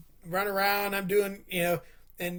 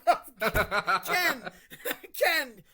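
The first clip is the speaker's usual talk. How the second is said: disbelieving laugh through the name, much higher; 5 semitones higher, much slower than usual. Retold or imitated. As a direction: laugh at him for not knowing. Laughing at friend not knowing.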